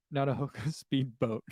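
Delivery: breaking into laughter while reading; slow down as the giggles take over the last words; laughing. Real, not acted. Reads a silly misprint, then cracks up.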